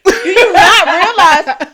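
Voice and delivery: high, loud, drawn out and laughing; slow mock disbelief. To a friend, slow loud laughing disbelief. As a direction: draw it out in disbelief. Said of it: "And I'm like".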